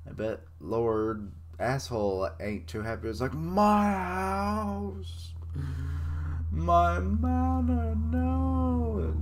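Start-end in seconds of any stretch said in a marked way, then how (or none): 3.3-9.1 high, drawn-out mock howl of despair; hammed-up anguished wail. Lord bewailing his collapsing house, hammed-up high wail. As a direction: wail it like a lord watching his manor fall.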